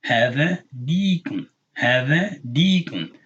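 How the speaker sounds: "deacon" with a drawn-out ee; long e sound. Shown as a demonstration. Teaching the kesra with ya, long e sound. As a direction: slowly stretch the ee in "deacon". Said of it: "to make a long e sound".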